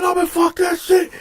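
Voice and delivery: high-pitched fighting retort; angry refusal. Retold or imitated. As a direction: re-enact rage mid-fight. Retold fight.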